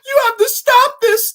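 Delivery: high, loud, one-note mock line delivery; mocking the actress. Reviewer mocks actress's one-note line, high and loud. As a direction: parody her flat single line. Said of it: "That's her whole performance".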